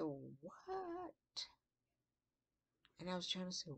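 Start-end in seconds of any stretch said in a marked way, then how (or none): 0.0-1.1 surprised what. Retold or imitated. Shocked reply.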